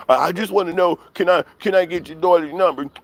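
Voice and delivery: high mocking caller voice. Streamer retelling the troll's call, mocking voice. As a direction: pitch up to mimic the prank caller.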